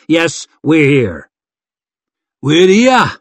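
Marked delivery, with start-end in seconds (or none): 2.4-3.1 up-and-down Hillman lilt, loud and high; singsong Hillman accent. A narrator's reading. Hillman chimes in after his chief, singsong Hillman accent. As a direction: sing it out in a swooping Hillman accent. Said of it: "sang Brackydane in his up-and-down Hillman accent".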